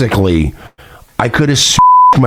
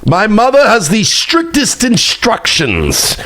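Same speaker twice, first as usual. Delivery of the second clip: mock lordly decree; 11 semitones higher than usual. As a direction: proclaim it like a pompous lord, pitched way up.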